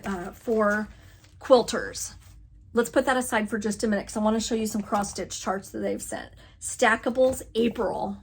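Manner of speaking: ordinary talk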